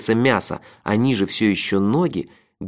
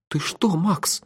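higher, slightly shaken question; trembling voice; 7 semitones higher, 4 dB quieter, much slower than usual. A narrator's reading. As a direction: ask with a touch of tremble. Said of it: "дрожащим голосом спросил Мелифара". (дрожащим голосом)

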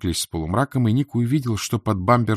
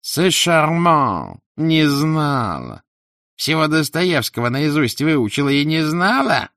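high, louder wheezing retort; a hint of a struggling wheeze, pitched up; laboured wheeze; 7 semitones higher, 4 dB louder, slower than usual. A narrator's reading. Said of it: "с трудом просипела Моргунова".

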